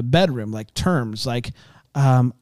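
ordinary delivery

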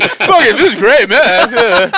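high, loud, giddy abandon; hammed-up carefree enthusiasm; acted stoned delight. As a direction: play a happily reckless user mid-hit. Acting delight with pipe in mouth.

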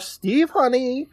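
high-pitched, drawn-out wifely call. Retold or imitated. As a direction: sing out slowly for your husband. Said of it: "she calls out".

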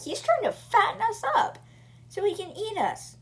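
terrified. Retold or imitated. Terrified girl realizes danger.

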